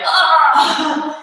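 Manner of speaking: pained grunt of effort; straining groan. Pretend. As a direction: groan like the burn hurts. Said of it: "you should be like".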